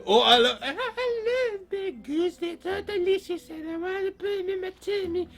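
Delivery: greedy, high falsetto fake granny; wolf posing as Grandma. Wolf as Grandma eyeing baked goods, greedy falsetto. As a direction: pitch way up as a wolf faking an old lady.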